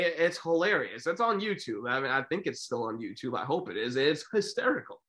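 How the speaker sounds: normal talking voice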